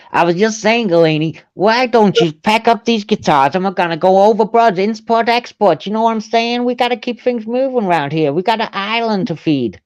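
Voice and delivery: high-pitched, put-on American wheeler-dealer; Jeffrey Epstein impression. Repeating the Epstein accent on request, hammed-up American patter. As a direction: hustle along in a mock New York accent. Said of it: "That's my accent for G".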